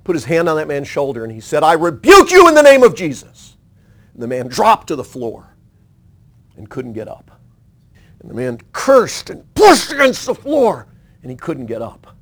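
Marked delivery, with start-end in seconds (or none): 1.6-3.6 high, loud acted preacher's rebuke; commanding rebuke. Preacher confronting a violent man, high loud rebuke. 8.7-10.9 high, loud, acted straining; straining effort. Pinned man fighting to get up, high loud strained telling.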